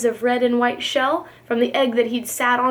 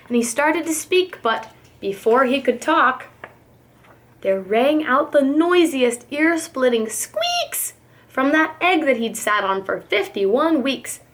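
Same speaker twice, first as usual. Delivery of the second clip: ordinary delivery, nothing marked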